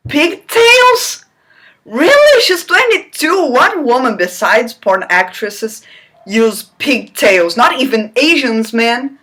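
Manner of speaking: high, loud disbelief; incredulous outburst. Real, not acted. Reader ranting at the book, high and loud. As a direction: scoff at the absurd detail. Reader's outraged aside on pigtails.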